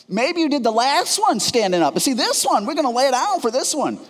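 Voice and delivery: patient protesting to the nurse, high pleading voice. Retold or imitated. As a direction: argue desperately with the nurse, voice up high. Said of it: "I said".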